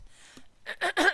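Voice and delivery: loud, rough throat clearing. Real, not acted. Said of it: "my voice is starting to go".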